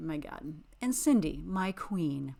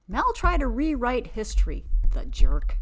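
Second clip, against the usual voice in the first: loud jab; 6 semitones higher, 9 dB louder than usual. Real, not acted. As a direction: fling the insult out hard. Angry aside about Mel.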